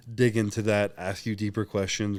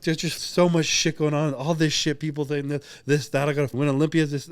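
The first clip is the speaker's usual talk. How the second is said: higher, louder mock-tearful outburst; retold bawling; 6 semitones higher, 4 dB louder than usual. Retelling a breakdown to his partner, mock bawling. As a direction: act out letting it all out, half in earnest. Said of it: "I start bawling. I'm crying".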